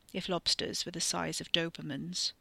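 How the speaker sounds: usual speaking voice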